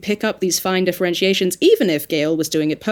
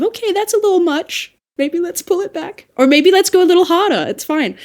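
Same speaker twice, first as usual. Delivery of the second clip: higher-pitched mock coach giving notes; tactful feedback; 9 semitones higher than usual. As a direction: gently suggest an adjustment, pitched up. Acted coach feedback on a take.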